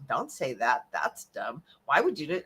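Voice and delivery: low, quiet, scornful inner critic voice. Retold or imitated. The speaker voicing the inner critic who puts her down, low and scornful. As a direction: sneer it down like the voice in your head. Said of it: "the critic is telling you all this".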